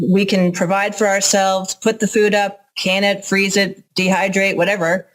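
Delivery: ordinary talk, nothing marked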